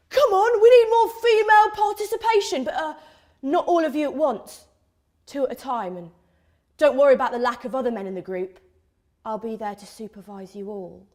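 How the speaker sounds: put-on rally the girls, then slyly reassure them; mock campus activist.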